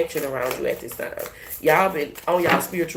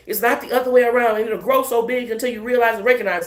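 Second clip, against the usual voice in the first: ordinary talk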